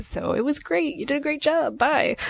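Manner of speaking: high, sugary brush-off from the inner people-pleaser; fake happy voice. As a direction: chirp it out to make them happy. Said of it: "the conflict avoiding monster that lives within us is like".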